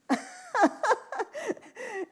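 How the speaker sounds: high bright burst of laughter; a real, amused laugh. Real, not acted. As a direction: laugh out loud at the story. Laughs at own story.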